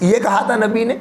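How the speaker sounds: ordinary talk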